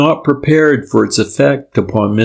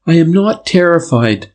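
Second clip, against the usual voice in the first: tremulous; 7 semitones higher than usual. A narrator's reading. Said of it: "she declared in tremulous tones".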